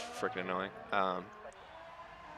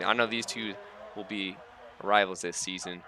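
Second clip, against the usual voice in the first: ordinary talk, nothing marked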